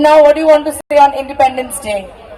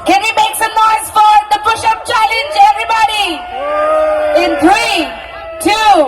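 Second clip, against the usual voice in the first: hype host; 7 semitones higher than usual. Real, not acted. Hyping crowd at event.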